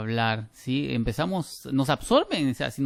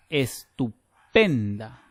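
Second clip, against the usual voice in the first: "estupenda" drawn out, PEN hit louder; stress on PEN; 4 dB louder, much slower than usual. Shown as a demonstration. Teacher showing students where the stress falls, stressed PEN in "estupenda". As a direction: split estupenda slowly, landing hard on PEN. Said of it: "Vean dónde cae, eh, el golpe de voz en intensidad".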